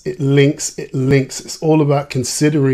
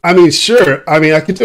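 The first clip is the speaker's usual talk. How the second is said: higher and louder, eager banter; lively, keen invitation; excited; 6 semitones higher, 5 dB louder than usual. Real, not acted. Guest excitedly invites host to Essex for a swap.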